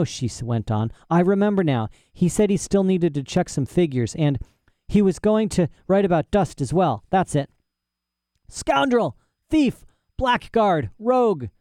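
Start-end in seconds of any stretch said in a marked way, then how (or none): 1.1-7.5 put-on higher-pitched girl, inventing as she goes; lightly voiced girl making it up; fibbing young heroine. Lyra makes up a tale for professor, artless. 8.5-11.5 put-on high-pitched furious old man; shouted insults. A narrator's reading. Old man raging at absent rival, shouting. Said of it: "shouted the old man".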